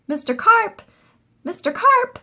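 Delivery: very high, loud calling; boy calling for Mister Carp under the water; yelled. A narrator's reading. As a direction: call out across the pool. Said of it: "I yelled".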